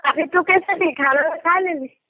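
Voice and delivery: normal talking voice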